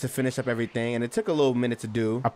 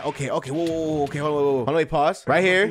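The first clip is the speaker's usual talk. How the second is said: usual speaking voice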